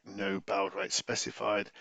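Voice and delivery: usual speaking voice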